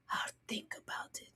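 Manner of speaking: dry whispered aside. Pretend. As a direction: mutter it under the breath. Mock deadpan reply.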